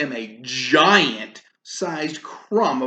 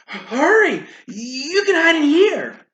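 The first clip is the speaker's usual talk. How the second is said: high, urgent hurry; 14 semitones higher, 6 dB louder than usual. Acted. Giantess urging Jack to hide, high and urgent. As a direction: rush him into hiding.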